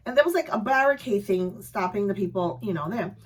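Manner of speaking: usual speaking voice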